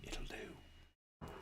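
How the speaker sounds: soft, voiceless whisper. Real, not acted. Builder settling for an imperfect sticker, whispered. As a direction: whisper it to yourself.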